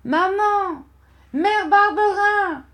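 put-on very high, long-drawn child's cry; desperate calling. A narrator's reading. Boy calls for help from the road, desperate cry. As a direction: call far out for mother, pitch way up. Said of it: "Je me mis à appeler".

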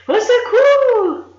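very high, loud, slow panicked cry; screaming for help. A narrator's reading. Buried under apples, Gronchon screams for help, very high and loud. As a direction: scream it as the apples bury you. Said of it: "hurla monsieur Gronchon".

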